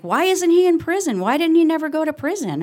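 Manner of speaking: put-on high, loud childlike outburst; indignant child. Daughter demands of her dad why he wasn't jailed, loud and high. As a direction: blurt it out as a shocked little kid. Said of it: "I was like".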